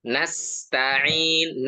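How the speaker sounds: ordinary delivery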